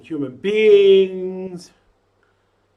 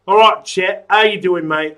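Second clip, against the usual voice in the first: put-on loud, cheery London accent; casual London accent greeting a viewer; 3 semitones higher, 8 dB louder than usual. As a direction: greet him like a London geezer. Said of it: "I'm just talking in a London accent for some reason".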